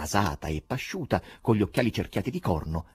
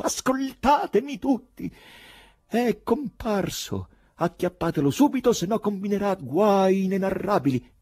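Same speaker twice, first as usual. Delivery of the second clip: strengthened, heated oratory; 5 dB louder than usual. A narrator's reading. Poet warning everyone to catch him, loud and heated. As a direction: rouse the crowd with a shout. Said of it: "si rafforzò e divenne più calda".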